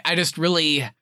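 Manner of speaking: usual speaking voice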